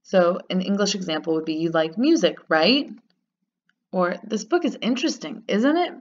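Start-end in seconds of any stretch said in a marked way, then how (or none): 1.6-2.9 RIGHT lifted as a checking question; statement, then a rising RIGHT at the end; tag question. Shown as a demonstration. Teacher gives an English example, tag question rising on RIGHT. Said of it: "making it a question of agreement". 4.2-5.9 ISN'T IT swooping up high at the end; tag question. Shown as a demonstration. Teacher gives an English example, tag question rising on ISN'T IT. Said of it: "making it a question of agreement".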